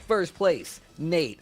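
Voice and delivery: racer voice. Retold or imitated. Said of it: "he's like".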